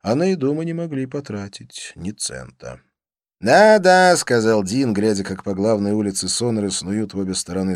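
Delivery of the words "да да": loud, high agreement; excited exclamation. A narrator's reading. Dean watching cattlemen in town, excited exclamation. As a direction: call it out with gusto.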